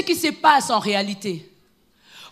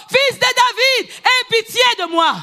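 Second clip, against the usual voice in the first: crying out; 8 semitones higher than usual. Acted. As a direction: cry out with all your heart. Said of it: "tu as besoin de crier encore". (cri suppliant et aigu)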